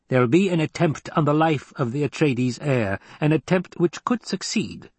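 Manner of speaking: precise, high-voiced scheming counsellor; analytical mentat. Voices Piter laying out plot.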